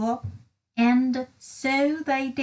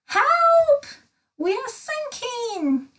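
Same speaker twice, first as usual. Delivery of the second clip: cry for help; 10 semitones higher, slower than usual. A narrator's reading. Little Miss Dotty panicking as the submarine dives, crying out. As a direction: shriek for help as the sub dives. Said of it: "cried little Miss Dotty".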